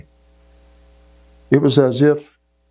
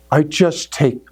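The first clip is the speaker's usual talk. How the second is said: normal talking voice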